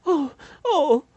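high, loud cry of pain; anguished wail. A narrator's reading. Mother crying out, overwhelmed by pain. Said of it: "叫唤道".

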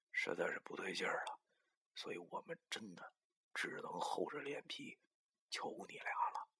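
embarrassed, breath-light request for help; hushed pleading. A narrator's reading. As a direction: beg quietly, with long hesitant pauses. Man pleading with two swindlers.

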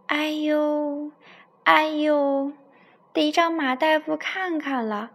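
a big tiger howling in pain, holding his cheek; wailing tiger. Acted. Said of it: "它捂着脸哇哇叫". (老虎牙疼哇哇大叫，声音又高又响)

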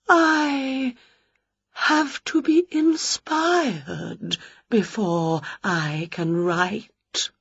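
very slow, soft trembling spook; a delicate poetic ghost, trembling; quivering ghost. Acted. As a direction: float each word out slowly. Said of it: "quivering voice".

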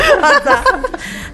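high giggling burst; burst of high laughter. Real, not acted. Laughing at the intro.

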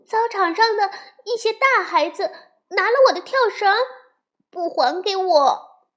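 put-on high, loud child voice; upset little bear sister. A narrator's reading. Upset little sister tells her mother. (委屈的小妹妹高声告状)

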